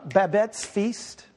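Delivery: normal talking voice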